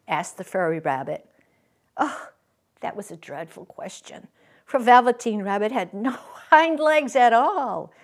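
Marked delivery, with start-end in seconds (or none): none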